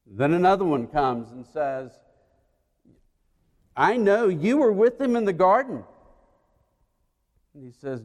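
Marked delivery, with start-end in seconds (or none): 3.8-5.8 insistent accuser. Acted. Another accuser.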